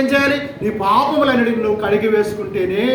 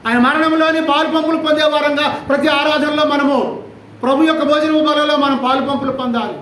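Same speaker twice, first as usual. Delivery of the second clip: raised, louder preaching questions; preaching at full force; 5 semitones higher, 3 dB louder than usual. Acted. Preacher challenging the congregation about baptism, forceful preaching. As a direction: press the congregation hard with each question. Exhorting daily discipline.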